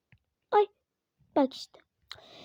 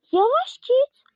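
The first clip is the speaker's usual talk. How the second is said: tearful plea; 7 semitones higher, 4 dB louder than usual. Real, not acted. Pleading with game character.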